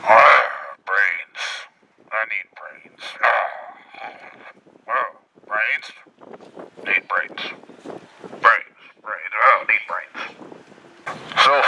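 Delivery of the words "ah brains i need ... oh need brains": low, quiet, groaning zombie; zombie moan. Pretend. Mocking shoppers as brain-hungry zombies, low groaning moan.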